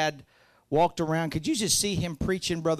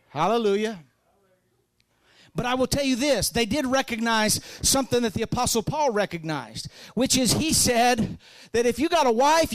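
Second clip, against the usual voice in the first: usual speaking voice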